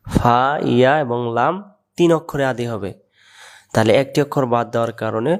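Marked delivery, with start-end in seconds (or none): none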